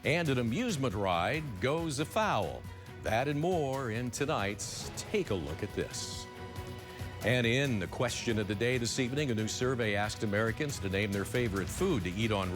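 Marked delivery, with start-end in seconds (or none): 0.0-6.3 news tease read. Acted. Anchor teases upcoming stories.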